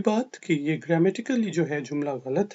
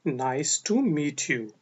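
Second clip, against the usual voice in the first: slow model phrase; 2 semitones lower, much slower than usual. Shown as a demonstration. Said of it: "slow model phrase".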